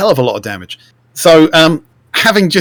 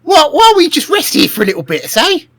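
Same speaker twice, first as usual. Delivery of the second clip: tired goblin voice; 14 semitones higher, 4 dB louder than usual. Acted. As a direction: whine like a knackered goblin. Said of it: "you basically say".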